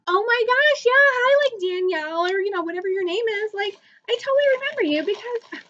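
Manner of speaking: very high, effusive re-enacted greeting; gushing recognition. YouTuber greeting a remembered fan, acted-out excitement. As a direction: squeal a delighted hello, then keep gushing high.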